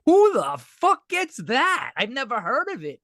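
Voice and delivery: high, slow stunned outburst; disbelief. Retold or imitated. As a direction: cry out in amazed disbelief. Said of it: "I'm like".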